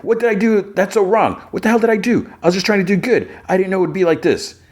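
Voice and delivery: very high, strained wail; timid guy's high-pitched outburst; retold screaming protest. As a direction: cry out in high-pitched mock desperation. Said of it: "where does this scream come from".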